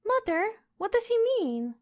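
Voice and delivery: put-on a small girl asking her mother, worried; plaintive little girl. A narrator's reading. Princess asks her mother, plaintive child's voice. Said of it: "asked the queen plaintively".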